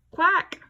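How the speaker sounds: high, loud quacking duck. Shown as a demonstration. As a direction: quack it for the children. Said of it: "Duck says quack".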